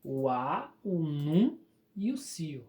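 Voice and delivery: measured, one syllable at a time: "a", "num", "cio"; separating syllables. Shown as a demonstration. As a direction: slowly list "a", "num", "cio" as separate pieces. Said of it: "Você vai separar aqui".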